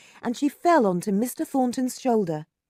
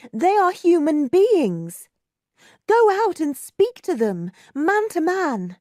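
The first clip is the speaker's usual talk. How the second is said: passionate plea; 9 semitones higher, 7 dB louder than usual. A narrator's reading. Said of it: "said Margaret passionately".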